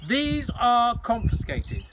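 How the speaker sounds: high, loud, slow pronouncement; stern confiscation. Acted. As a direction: lay down the law slowly. Matron seizing the tin.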